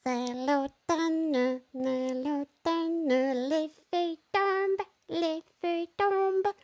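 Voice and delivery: high, squeaky little mouse singing. Shown as a demonstration. Teacher showing kids a silly mouse voice, high and slow. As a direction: sing it like a tiny mouse. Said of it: "do a little mouse voice".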